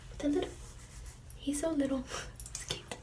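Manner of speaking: plain reading voice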